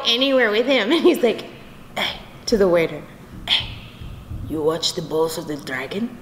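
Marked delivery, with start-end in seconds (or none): 2.0-3.7 imitating Spanish-accented dad calling a waiter; short Spanish-accented call for attention; dad's Spanish accent. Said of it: "when I do the accent". 4.5-6.1 put-on low Spanish-accented dad quizzing a waiter; low-voiced question in a Spanish accent; dad's Spanish accent. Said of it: "when I do the accent".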